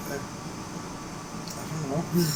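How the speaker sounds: near-silent, slow stammer; stunned quiet. Retold or imitated. Caught between angry customers, a stunned near-silent mumble. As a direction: freeze up and barely get it out. Frozen under customer's stare.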